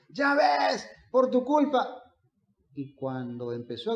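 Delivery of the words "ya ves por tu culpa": mock yell of blame, pitched well up; retold shout. Father blaming his wife after the child's fall, imitated shouting. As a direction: imitate a husband's high, raised-voice scolding. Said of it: "empezó a gritarle a la esposa".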